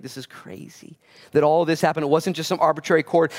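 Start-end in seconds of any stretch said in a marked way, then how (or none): 0.0-1.0 awed whisper. Retold or imitated. Preacher voicing his own thought about the cord, whispered. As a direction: whisper it in wonder.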